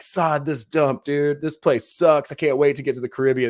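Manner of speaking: mock griping. Imagined holidaymaker slags off home before a Caribbean trip, mock griping. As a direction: gripe loudly as an imagined fed-up holidaymaker.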